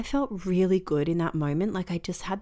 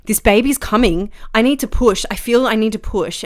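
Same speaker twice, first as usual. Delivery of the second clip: louder, pressing repeat; urgent insistence; 4 semitones higher, 7 dB louder than usual. Retold or imitated. In labour, insisting to husband she must push, loud. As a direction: insist the baby is coming.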